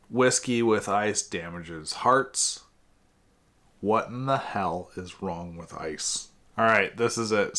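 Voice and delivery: usual speaking voice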